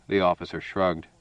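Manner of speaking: plain reading voice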